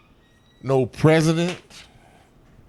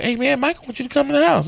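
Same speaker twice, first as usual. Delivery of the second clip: quick, higher, excited Chris Tucker voice; a light take on Chris Tucker's eager pitch; imitating Chris Tucker; 11 semitones higher, faster than usual. Chris Tucker pitching the visit to his friend, quick and high. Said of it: "Chris Tucker said".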